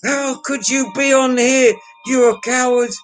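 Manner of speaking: high-pitched mock voice of an online troll; a hammed-up, high caricature of an angry critic; mocking angry commenter. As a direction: squeak the insult back in a silly high voice. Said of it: "He said".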